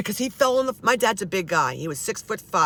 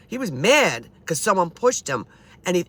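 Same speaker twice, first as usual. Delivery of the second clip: usual speaking voice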